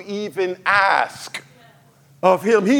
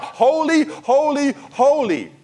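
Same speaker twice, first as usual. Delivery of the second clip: crying out holy; 6 semitones higher, slower than usual. Retold or imitated. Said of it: "We were crying out".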